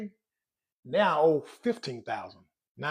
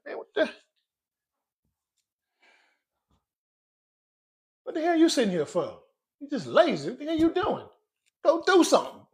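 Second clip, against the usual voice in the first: higher-pitched, exasperated telling-off; mock angry scolding; 10 semitones higher than usual. Owner telling off money for sitting there lazy, mock angry. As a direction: scold like a fed-up boss, half in jest. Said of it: "I'm mad. I be like".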